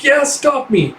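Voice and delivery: high taunting dare; teasing mock dare. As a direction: sing it as a playful taunt.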